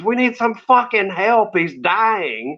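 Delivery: high-pitched retold cry for help; mock scream. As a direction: imitate a panicked scream without real volume. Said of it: "over my ears and screams".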